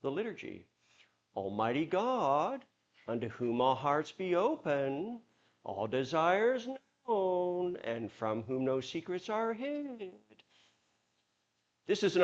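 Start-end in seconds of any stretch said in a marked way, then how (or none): 1.3-10.1 drawn-out prayer, higher, "God", "open", "known" and "hid" rising at the end; liturgical uptalk. Shown as a demonstration. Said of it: "then you bring it into the liturgy".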